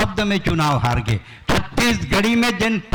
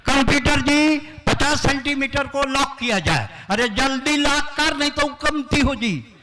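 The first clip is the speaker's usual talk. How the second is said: eager contestant; 7 semitones higher than usual. Pretend. Contestant locks quiz answer.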